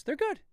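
"good" said high and bright with a lift; high chirpy "good". Shown as a demonstration. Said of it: "with that inflection".

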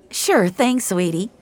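high, sweet server voice; friendly waitress. Acted. Waitress to little girl, friendly. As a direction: indulge the kid sweetly.

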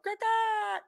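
high squawking goose call; goose call imitation. Imitates geese calling out from rooftops with a high squawk. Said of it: "I did the same noise three times".

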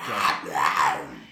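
a loud mock Bowser growl; Bowser roar. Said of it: "that was supposed to be Bowser".

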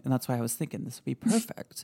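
normal talking voice

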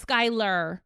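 "Skylar" slowly with a heavy final R; extra R. Retold or imitated. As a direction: overdo the R ending "Skylar". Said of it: "I say it, like, extra".

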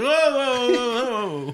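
alarmed. Retold or imitated. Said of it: "You're like".

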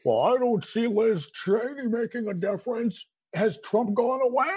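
high, drawn-out mimicry of a naysayer; mocking critic's comeback. Host imitating critics of Liz Cheney, mocking and drawn-out. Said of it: "the comeback I get to this, Cliff, is".

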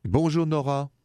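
a light French bonjour; French-accented greeting. Acted. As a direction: greet her like a suave Frenchman. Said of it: "French accent".

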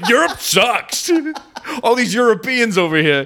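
high, whiny put-on tourist voice; mock whiny tourist. Tourist complaining in Australia, high mock whine. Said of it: "you're in Australia and you're like".

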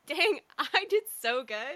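high, loud, drawn-out mock pride; mock self-congratulation. Host teasing actor about the audition, mock self-congratulation. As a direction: imitate someone thrilled with themselves, half laughing.

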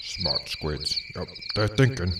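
deflated mutter. Acted. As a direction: mumble it like the joke flopped. Deflated aside after joke.

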